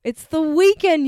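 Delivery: drawn out, high and loud; excited. Acted. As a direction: sing out the weekend with glee. Friend echoes phrase excitedly.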